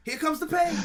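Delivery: high, loud mock Taz catchphrase; Taz impression. Host mimicking Taz's catchphrase to his co-hosts. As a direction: belt out Taz's catchphrase as a gag. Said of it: "It's Taz".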